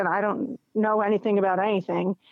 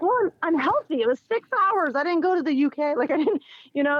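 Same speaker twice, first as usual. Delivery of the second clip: higher and fast, reasoning in disbelief; incredulous self-talk; 7 semitones higher than usual. Retold or imitated. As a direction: talk yourself out of panic, pitched up and quick. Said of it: "I'm like".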